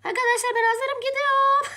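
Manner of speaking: high, loud, gleeful; excited squeal before the trip. Real, not acted. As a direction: squeal it out, thrilled to leave.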